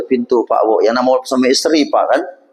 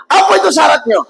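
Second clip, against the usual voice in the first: bersemangat; 13 semitones higher, 3 dB louder than usual. Acted. Pemuda bertanya penuh harap.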